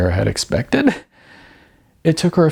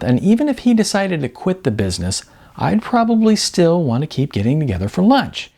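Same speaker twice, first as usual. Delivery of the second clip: higher, brisk and candid agent's voice; blunt talent agent; 6 semitones higher than usual. Acted. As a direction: sell the hard truth with sugary frankness. Beth explains her business.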